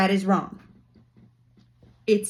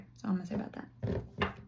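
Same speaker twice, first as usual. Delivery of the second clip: near-whisper, much softer; hushed aside; 2 semitones lower, 12 dB quieter, faster than usual. Real, not acted. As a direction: drop to a whisper to close the subject. Drops a sensitive subject, aside.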